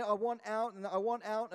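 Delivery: ordinary talk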